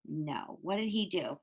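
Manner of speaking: usual speaking voice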